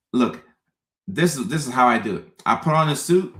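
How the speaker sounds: ordinary talk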